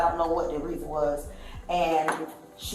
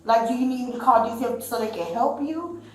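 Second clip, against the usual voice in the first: retold firm pleading, higher pitched; exasperated refusal; 6 semitones higher than usual. Witness refusing mother and offering help, exasperated. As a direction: replay your exasperated attempt to get through.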